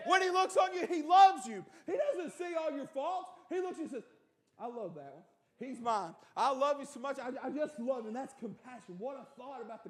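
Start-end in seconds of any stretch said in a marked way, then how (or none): 0.1-8.1 shouted, high preaching; preaching at full force. Acted. Preacher shouting to the congregation about God's love, at full force.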